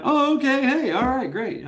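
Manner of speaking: high, loud, hammed-up eager agreement; mock delighted surprise. Guest greeting the plumber, with mock delighted surprise. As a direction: play it like a guest thrilled by any answer. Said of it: "you're like".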